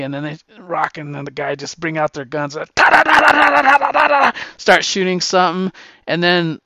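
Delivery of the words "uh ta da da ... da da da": loud, high mock machine gun rattle; gunfire noise. Rock and the guy bringing out their guns, loud gun noise. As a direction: rattle off the shots like a kid playing soldiers.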